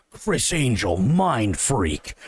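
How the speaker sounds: low, dramatic announcer read of mind freak; mock deep announcer; TV tagline voice. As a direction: boom it like a cheesy show intro. Mimicking TV show tagline.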